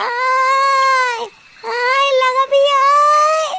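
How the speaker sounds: slow ghostly wail. Acted. Petni luring the frightened man, slow eerie wail. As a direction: stretch every word like a witch calling from far off.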